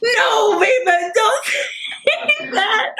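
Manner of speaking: high, drawn-out fangirl whine breaking into laughter; playful wailed protest. Retold or imitated. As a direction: wail it high and stretched, half laughing. Retold protest to idol, laughing.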